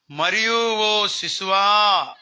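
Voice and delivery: long drawn-out address to an infant; scriptural proclamation. Acted. Preacher quoting Zechariah to his baby, solemnly drawn out. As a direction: declaim it slowly, stretching the final vowel.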